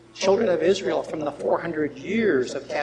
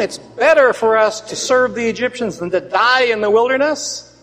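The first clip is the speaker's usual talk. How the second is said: loud complaint; 6 semitones higher, 6 dB louder than usual. Acted. Quoting grumbling Israelites to Moses.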